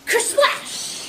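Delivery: high, loud crashing splash; sound-effect word. A narrator's reading. Storyteller as the wave hits Pete's boat, explosive sound effect.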